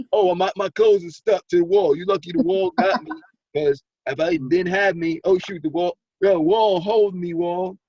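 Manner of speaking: loud, high street tough picking a fight; wolf-ticket tough guy. Retold or imitated. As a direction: bark out the challenge like a bluffing tough guy. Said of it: "the selling wolf tickets".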